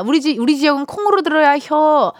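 put-on playful Chungcheong accent; Chungcheong dialect. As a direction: tease in a Chungcheong twang. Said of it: "충청도인가비요".

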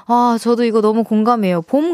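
ordinary talk

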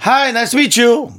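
mock rude foreigner.

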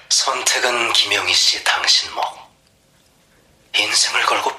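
hushed sinister whisper; whispering mysterious caller. Acted. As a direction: whisper it like a temptation. Caller whispers his offer.

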